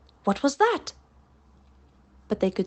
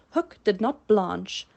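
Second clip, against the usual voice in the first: plain reading voice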